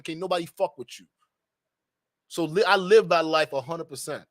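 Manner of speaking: normal talking voice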